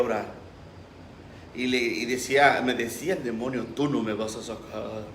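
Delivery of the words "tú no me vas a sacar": demon voice. Retold or imitated. El demonio le habla al pastor.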